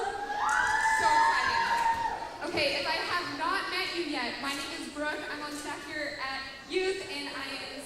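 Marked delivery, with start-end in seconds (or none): 0.0-2.5 much higher, slow, loud squeal; hammed-up delight for the kids; excited squeal. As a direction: stretch it out like a thrilled cheer. Hyping up middle schoolers.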